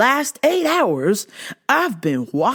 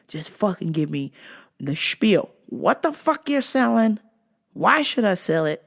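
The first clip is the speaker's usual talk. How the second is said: ordinary talk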